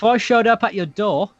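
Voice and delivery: high-pitched Aussie attempt gone British; accidentally British accent. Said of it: "oh, that's British".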